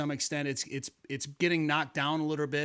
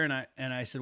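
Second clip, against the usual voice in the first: ordinary delivery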